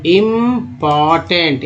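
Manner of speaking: "Important" drawn out syllable by syllable; slow syllable demo. Shown as a demonstration. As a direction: stretch each part of "important". शब्द धीरे तोड़कर पढ़ाना. ("Important" खींचकर, धीरे-धीरे)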